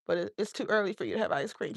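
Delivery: ordinary talk, nothing marked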